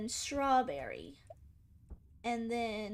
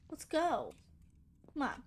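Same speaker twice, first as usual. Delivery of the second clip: ordinary delivery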